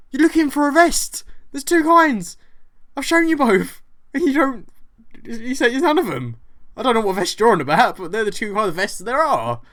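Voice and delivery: high, loud exasperated rant. Retold or imitated. Shop worker rants at the rude vest customer, high and loud. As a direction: vent at her like she's still there.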